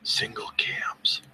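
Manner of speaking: slow close whisper; whispered into the mic. Shown as a demonstration. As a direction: breathe the name right into the mic. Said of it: "I'm gonna whisper it into the microphone".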